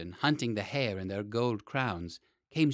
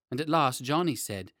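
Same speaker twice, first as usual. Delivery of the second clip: ordinary delivery, nothing marked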